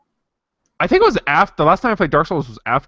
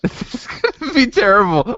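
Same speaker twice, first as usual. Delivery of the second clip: giggling dread; 12 semitones higher, 3 dB louder than usual. Real, not acted. Giggling before embarrassing accent attempt.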